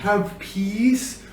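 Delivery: soft, hushed wish of peace; gentle, peaceful. Shown as a demonstration. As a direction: say it very softly. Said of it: "and peace".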